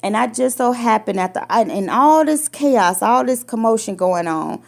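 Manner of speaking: ordinary talk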